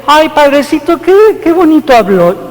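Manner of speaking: put-on gushing old lady. Priest imitates a lady praising his homily, high gushing voice. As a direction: coo it like a charmed churchgoer. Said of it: "una señora me dice".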